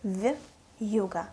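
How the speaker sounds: "Вьюга" with a y-glide after the soft в; separated consonant and vowel. Shown as a demonstration. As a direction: split "Вьюга" as v-yuga. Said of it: "when the consonant and vowel separated".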